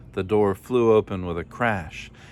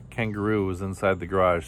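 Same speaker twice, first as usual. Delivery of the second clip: usual speaking voice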